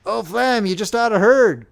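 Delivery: high, excited call; bursting to share the news; eager. A narrator's reading. Soldier rushing up with great news, eager. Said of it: "cried one eagerly".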